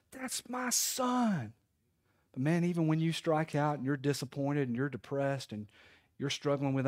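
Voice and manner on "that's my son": high, drawn-out call held back in volume; a long proud holler at restrained volume; proud cheer. Acted. God cheering when you get a hit, proud call. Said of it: "you feel like the Lord's saying".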